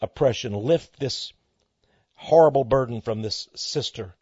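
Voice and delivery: soft, low retold prayer; hushed plea to Jesus; quiet prayer. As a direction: pray gently over her. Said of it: "and I said".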